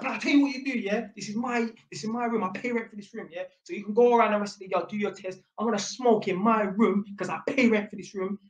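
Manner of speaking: fast, high-pitched argumentative rant; imitated defiant son, quick and high; quarrelsome retort. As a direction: rattle off a territorial comeback, pitched high. Son's side of acted quarrel.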